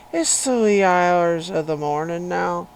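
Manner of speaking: loud, hammed-up Irish brogue; playful Irish lilt at full volume; Irish accent. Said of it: "in an Irish accent".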